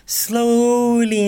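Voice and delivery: SLOWLY stretched long on a high held note; drawn-out slowly. Real, not acted. Vlogger easing into cardio, drawn-out slowly. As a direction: draw out the first vowel of "Slowly", high and loud. Stretches the word to act slowness.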